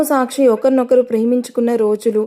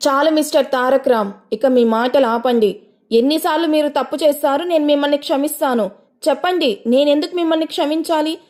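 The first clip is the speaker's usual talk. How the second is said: higher, sharp angry outburst; shouting back; 4 semitones higher than usual. A narrator's reading. Wife rejecting her husband's apology, angry higher outburst. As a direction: throw his apology back at him. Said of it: "గట్టిగా అరిచింది".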